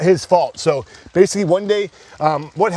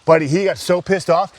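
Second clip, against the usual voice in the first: usual speaking voice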